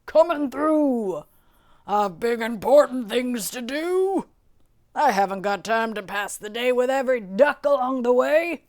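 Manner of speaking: loud, self-important truck voice; yelling dump truck. A narrator's reading. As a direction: bellow it like a truck in a hurry. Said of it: "yelled a dump truck".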